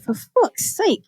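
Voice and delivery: slow, high, breathy despair; exasperated groan. Retold or imitated. Reacting to the film's suicides, slow exasperation. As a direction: drag it out in disbelief.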